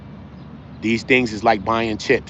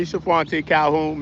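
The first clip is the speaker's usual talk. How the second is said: usual speaking voice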